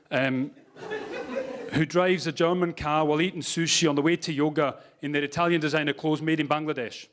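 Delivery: put-on lilting Scottish accent. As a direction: talk as if you were a Scot. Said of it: "you might be able to tell from my accent".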